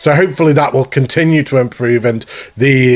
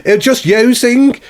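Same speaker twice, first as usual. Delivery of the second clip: mock Brummie accent; 9 semitones higher than usual. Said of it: "I sounded like a Brummie or something".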